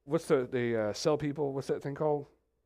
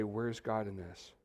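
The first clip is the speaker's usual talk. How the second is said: despairing question; 5 semitones lower, 10 dB quieter, slower than usual. Retold or imitated. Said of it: "you're gonna say".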